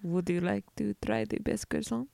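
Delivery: a failed stab at a French waiter; bad French accent. Offering a croissant in a badly faked French accent. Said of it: "I can't do a French accent".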